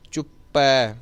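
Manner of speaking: ਚੁੱਪੈ ending in open "ai"; correct ai ending. Shown as a demonstration. Teacher pointing out vowel sign, open ai ending. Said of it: "ਪੱਪੇ ਨੂੰ ਦੁਲਾਵਾਂ ਲਾਉਣੀਆਂ".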